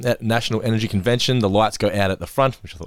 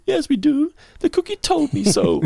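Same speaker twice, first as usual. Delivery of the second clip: squeaky, much higher Homer voice; Homer impression. Host quoting Homer's cookie line in a squeaky Homer voice. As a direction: pitch way up like a whiny Homer.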